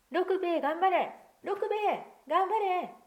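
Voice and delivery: high, loud repeated cheers; children all urging him on; calling out. Acted. Children shouting encouragement into the hole, loud. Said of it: "みんな口々に言いました".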